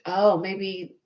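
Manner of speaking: usual speaking voice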